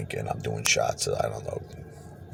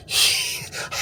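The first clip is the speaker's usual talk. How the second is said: shrieking laugh; 5 dB quieter than usual. Real, not acted. Reacting to the jackpot.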